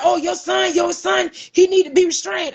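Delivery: high, fast excuse; mock the deputy's excuse; a guard making excuses; defensive deputy. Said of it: "He was like".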